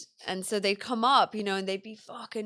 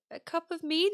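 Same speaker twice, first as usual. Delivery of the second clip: mock serving maid offer; 8 semitones higher than usual. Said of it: "You'd be like".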